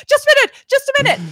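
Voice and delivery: high, loud mock panic; hammed-up frantic plea to hold on; freaking out. Woman drying her legs behind the door, freaking out. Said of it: "And she's freaking out".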